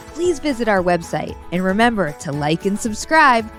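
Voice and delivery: ordinary delivery, nothing marked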